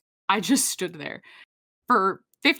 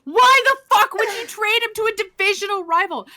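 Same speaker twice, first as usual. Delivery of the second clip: much higher and louder, exasperated; outraged rant; 11 semitones higher, 6 dB louder than usual. Real, not acted. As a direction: blow up at the absurd trade. Fan outraged about the trade.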